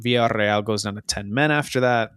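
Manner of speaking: usual speaking voice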